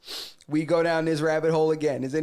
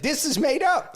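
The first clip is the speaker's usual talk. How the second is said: emphatic declaration; 10 semitones higher than usual. Retold or imitated. Acting out his own verdict.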